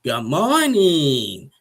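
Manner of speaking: high, slow, drawn-out sing-song; mocking sing-song greeting. Thais greeting John in an exaggerated sing-song voice. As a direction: stretch each morning out sweetly and high. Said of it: "Thais is just like".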